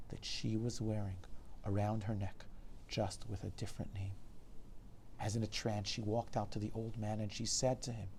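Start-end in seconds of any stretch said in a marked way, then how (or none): none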